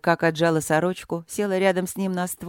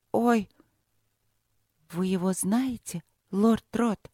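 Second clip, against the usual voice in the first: horrified; 3 semitones higher, slower than usual. A narrator's reading. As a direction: let the horror dawn between the pauses. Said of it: "с ужасом спросила она". (с тихим ужасом, запинаясь)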